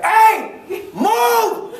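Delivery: high, loud road-rage yell; mad shout. Acted. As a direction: yell it out like an angry driver in traffic. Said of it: "I got mad".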